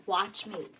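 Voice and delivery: normal talking voice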